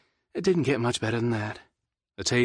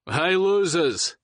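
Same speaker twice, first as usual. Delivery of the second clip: slow, high taunting call; 8 semitones higher, 4 dB louder, much slower than usual. Acted. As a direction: call out, teasing. Brent calling from embankment.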